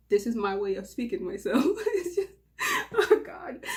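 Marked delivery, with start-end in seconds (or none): 1.6-3.6 high laughter breaking the words; giggling with embarrassment; embarrassed laughing. Real, not acted. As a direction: laugh through it, high and giddy. Embarrassed laugh while confessing.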